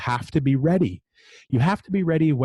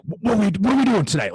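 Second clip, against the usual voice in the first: quick, much higher, eager imitation of the GM; fired up; 10 semitones higher, faster than usual. General manager at nine in the morning, fired up and quick. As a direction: play the GM pumped up and raring to go. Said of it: "he's, like, fired up".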